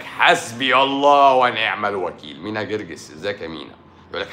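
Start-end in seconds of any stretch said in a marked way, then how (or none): 0.0-2.4 slow, louder, drawn-out mock prayer; mock pious lament. Doctor imitating a bitter commenter's complaint, slow, louder mock prayer. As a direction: intone it like a wronged believer calling on God.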